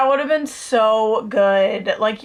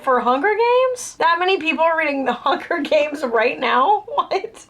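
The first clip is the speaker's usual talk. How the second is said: high-pitched disbelieving questions; incredulous; 5 semitones higher than usual. Retold or imitated. Reader reacting to the long library wait for Hunger Games, incredulous. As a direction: react in astonished disbelief, voice jumping up.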